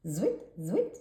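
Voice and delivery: quick zwit zwit noise; zwit sound effect. A narrator's reading. Narrator flips pages, a zwit zwit noise. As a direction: make the page-flip noise.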